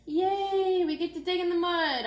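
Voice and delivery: high, loud cheer; excited shout. Acted. Child cheering about the class garden, excited shout. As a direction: whoop it out like a thrilled kid. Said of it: "I shouted".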